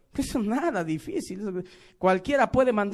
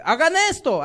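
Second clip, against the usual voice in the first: "Hagan esto" barked out louder, "Hagan" hit hard; strong commanding voice; 6 semitones higher, 5 dB louder than usual. Shown as a demonstration. Preacher showing how bosses order people around, loud commanding voice. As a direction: command it with full authority. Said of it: "poniendo voz más fuertes".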